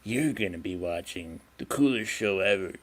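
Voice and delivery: low, soft show-intro voice; hushed, low mock-dramatic host; William Shatner. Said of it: "like, William Shatner".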